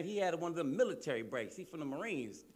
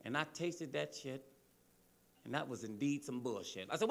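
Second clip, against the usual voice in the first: usual speaking voice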